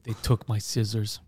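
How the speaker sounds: low, slow deadpan complaint; George. Retold or imitated. Traveller answering a cheery greeting, low slow gloom. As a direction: drop way down and slow, glum impression. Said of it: "And George's like".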